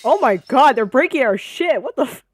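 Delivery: loud high shout of disbelief; real indignant shouting; outraged yell. Real, not acted. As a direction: yell at the screen as they wreck the shop. Shop being wrecked.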